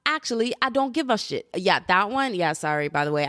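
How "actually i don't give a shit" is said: high-pitched mock British rapper; badly faked British accent, rap cadence. Podcaster copying the song she just played, bad British accent. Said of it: "the accent that I just tried to do".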